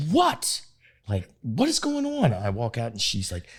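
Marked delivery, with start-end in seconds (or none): 0.1-2.3 high, alarmed calling out; husband calling to a panicking wife, alarmed; startled. Retold or imitated. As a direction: call out high in sudden alarm. Hearing his wife freak out.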